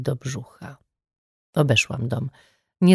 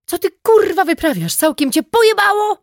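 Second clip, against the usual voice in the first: much higher and louder, furious scream; furious yell; 5 dB louder than usual. A narrator's reading. Detective screaming at the man who just fired a shotgun. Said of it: "wrzasnęłam".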